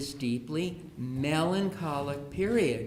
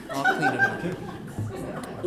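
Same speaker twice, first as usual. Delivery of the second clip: much higher, slow, breathy falsetto; mock tragic squeak with a laugh; martyred child; 4 dB louder, much slower than usual. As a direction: whimper it like a self-pitying kid.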